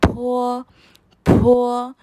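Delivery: फो with a strong breath of air; aspirated फो, puff from the lips. Shown as a demonstration. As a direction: say फो so the paper moves. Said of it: "कहना है".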